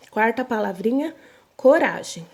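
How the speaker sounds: ordinary talk, nothing marked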